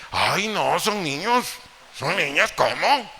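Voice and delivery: hammed-up excuse from a doting mother; mock whiny mother. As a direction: act the indulgent mom brushing it off. Mother excuses children, acted.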